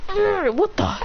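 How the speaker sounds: mock-startled high yelp. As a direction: caught off guard mid-act. Acted startled reaction in retold fight.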